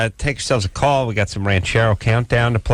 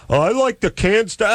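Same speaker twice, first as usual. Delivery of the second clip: higher, mocking imitation of a canned-food fan; mocking a fool; 8 semitones higher than usual. Host mocking a guest who likes canned sauce, light mock voice. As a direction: lightly voice a dimwit you scorn. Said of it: "you get this jack off".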